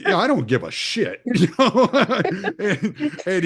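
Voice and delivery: high laughing talk. Real, not acted. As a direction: break into laughter mid-story. Laughing while retelling.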